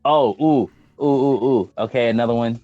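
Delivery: usual speaking voice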